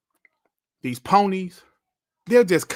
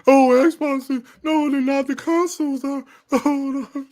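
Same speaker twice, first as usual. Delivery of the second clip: higher, hammed-up whiny panic, then louder; mock outraged hater; 8 semitones higher, 7 dB louder than usual. Streamer mocking Xbox haters with hammed-up, higher panic. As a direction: parody a panicking fanboy.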